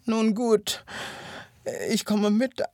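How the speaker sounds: high-pitched, halting invalid voice; feeble whining hare. Acted. Hare asking the hyena to carry him, whining feebly. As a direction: give in haltingly, playing the weak patient.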